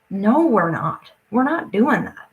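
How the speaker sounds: usual speaking voice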